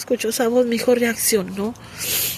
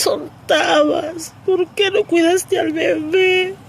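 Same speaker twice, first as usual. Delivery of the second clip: retold crying shout; 6 semitones higher than usual. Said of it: "Yo le gritaba a Dios".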